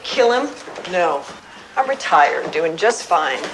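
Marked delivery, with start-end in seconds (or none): 0.0-0.5 higher, sharply rising question; incredulous echo. Acted. Retired producer echoing the detectives' suspicion, incredulous. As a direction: toss the accusation back in disbelief. Suspect repeats the accusation.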